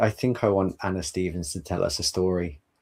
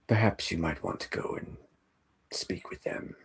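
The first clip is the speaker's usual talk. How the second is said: hushed train host; 5 dB quieter than usual. Acted. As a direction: guide them on gently, trailing into near-whisper. Game master plays train character, hushed.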